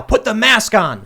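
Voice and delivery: loud, slow barked order; yell. Retold or imitated. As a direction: yell it across the room. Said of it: "I hear someone yell".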